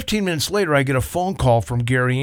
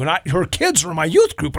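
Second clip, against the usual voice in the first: ordinary delivery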